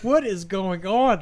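loud, high, drawn-out bewilderment; baffled exclamation. Retold or imitated. Man on the phone to hysterical friend, loud bewildered cry. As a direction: stretch out each word in mock disbelief.

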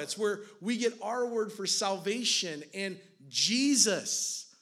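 loud, high, driving sermon voice; impassioned preacher pleading for a drowning king; preaching at full force. Acted. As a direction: preach it hard, pressing every line.

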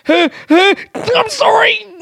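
stretched, very high drawn-out "I'm sorry"; mock apology. Mocking a stranger's fake apology, high and stretched. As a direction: pull "I'm sorry" way up and out slowly.

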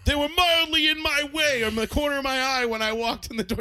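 high, loud mocking rant; mock dad rant. Kid mocking dad's complaint about coats on the rack. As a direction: mimic the angry father's excuse. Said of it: "And you're like".

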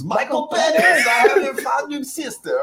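high, loud, slow laughing shout; screaming. Retold or imitated. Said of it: "And he screams".

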